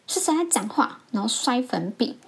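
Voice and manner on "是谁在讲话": raised pitch, scolding demand; mock teacher's outburst; retold angry outburst. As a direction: mimic a teacher suddenly blowing up at the class. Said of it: "他突然一个大爆发".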